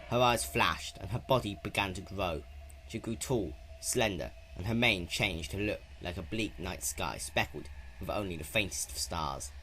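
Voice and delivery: plain reading voice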